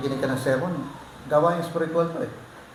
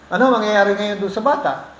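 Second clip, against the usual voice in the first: loud, higher rhetorical question; loud preaching; 6 semitones higher, 6 dB louder than usual. Real, not acted. Pastor asks the congregation about the child, loud and pitched up. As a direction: call it out to the congregation.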